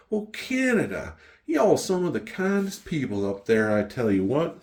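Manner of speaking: high, gushing imitation of a local lady; imitated folksy, effusive praise; friendly local ladies. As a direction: play a shop lady delighted by a Canadian. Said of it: "the ladies were there, like".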